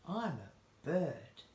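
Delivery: slow, proud, drawn out; proud parrot imitation. Owner imitates the parrot saying its phrase with pride, slowly. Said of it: "You say it with pride, though, hey, Dolly? You say".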